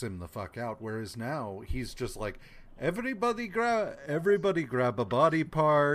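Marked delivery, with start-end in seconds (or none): 2.8-6.0 put-on vaguely Russian-sounding accent, high start, louder; botched German that slid toward Russian; half-hearted German accent gone Russian; weird accent. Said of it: "that was a weird accent".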